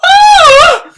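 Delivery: very high, loud startled scream; the maid seeing a girl on the sill. A narrator's reading. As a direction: shriek in fright. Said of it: "The maid screamed".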